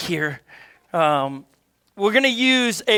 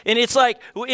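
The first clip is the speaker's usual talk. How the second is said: ordinary delivery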